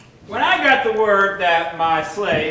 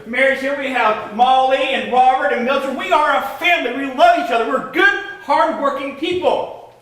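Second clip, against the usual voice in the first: rising temper; 6 semitones higher than usual. Acted. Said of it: "no idea what kind of a temper he has".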